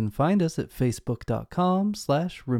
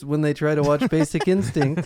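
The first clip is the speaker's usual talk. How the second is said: ordinary talk, nothing marked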